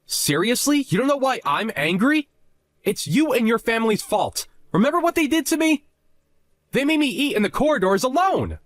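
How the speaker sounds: high-pitched, aggrieved protest; indignant outburst. Acted. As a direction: argue back in a high, offended voice. Husband erupts over corridor meal.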